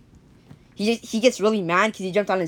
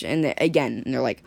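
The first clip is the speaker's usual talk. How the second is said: plain reading voice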